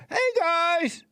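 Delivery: squeaky high "Hey, guys" mocking his own voice; high-pitched mock greeting. Mocking the caller's critique of his voice, high and thin. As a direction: chirp it up high like a thin little voice. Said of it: "He need to put some man voice in his voice".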